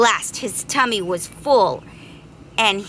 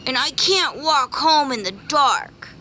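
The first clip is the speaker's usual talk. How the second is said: wailing bee; 6 semitones higher than usual. Acted. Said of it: "wailed the greedy bee".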